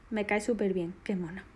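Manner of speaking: usual speaking voice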